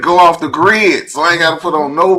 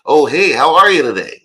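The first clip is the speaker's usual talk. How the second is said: louder, put-on polite greeting; 5 dB louder than usual.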